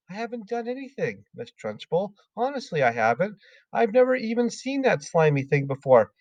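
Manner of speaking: innocent small voice; girl surprised at being accused; Matilda. Acted.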